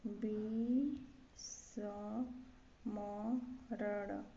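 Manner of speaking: विस्मरण drawn out with retroflex ending; spelled by syllables. Shown as a demonstration. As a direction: dictate विस्मरण very slowly with gaps. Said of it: "विस्मरण होता है".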